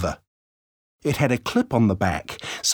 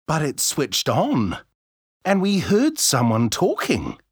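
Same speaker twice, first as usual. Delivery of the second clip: put-on higher, excited boy on the radio; chirpy kid reporting in; young boy voice; 5 semitones higher than usual. A narrator's reading.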